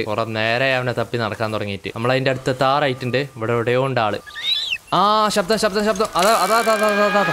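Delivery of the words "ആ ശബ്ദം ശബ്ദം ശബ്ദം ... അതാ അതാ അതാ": high, loud urgent pointing; excited alarm. Real, not acted. Gamer alerting viewers to a dinosaur nearby, high and loud. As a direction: spot it and sound the alarm, high.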